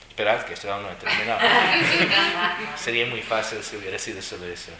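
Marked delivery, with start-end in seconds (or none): 1.4-2.7 squealing high laugh; real laughter at the story; high burst of laughter. Real, not acted.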